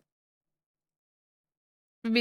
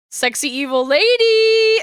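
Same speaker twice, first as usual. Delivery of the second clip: excited squeal; 8 semitones higher than usual. Real, not acted. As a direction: squeal the name out. Gushing over character image.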